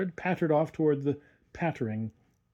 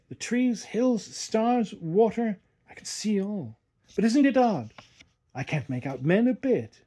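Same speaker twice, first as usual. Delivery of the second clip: put-on higher, eager young boy; boy's voice; 7 semitones higher than usual. A narrator's reading. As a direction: lift into the boy Eustace, wondering. Narrator voices boy Eustace.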